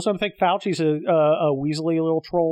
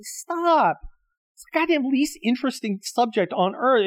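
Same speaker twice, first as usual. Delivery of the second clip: pretend snap; 8 semitones higher than usual. Imagined snapping at people.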